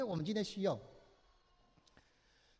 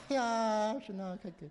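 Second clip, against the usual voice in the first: mock crying prayer; 2 semitones higher, 7 dB quieter than usual. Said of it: "每次来祷告先哭一包".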